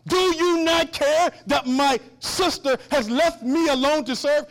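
loud, high, slow acted complaint; Martha, mad. Martha complaining to Jesus about her sister, angry. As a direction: lay out the grievance word by word, indignantly. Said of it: "and she's mad".

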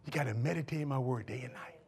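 low, very soft instruction; hushed. Acted. God giving the key to success, hushed. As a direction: drop to a hush for the key. God's instruction voiced in a hush.

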